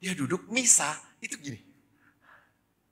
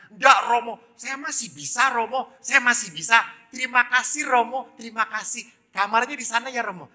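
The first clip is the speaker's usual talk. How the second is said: put-on high, loud, eager child voice; schoolchild; 11 semitones higher, 8 dB louder than usual. Polio boy declines priest's help, high eager child voice. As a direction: insist brightly like a plucky kid. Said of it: "Saya ketemu anak S D".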